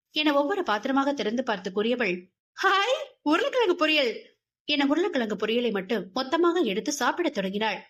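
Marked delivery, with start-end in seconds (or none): 2.6-4.1 delighted squeal. Acted. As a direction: squeal over the potato fry. Girl finds favourite dish. (மகிழ்ச்சியான கூச்சல்)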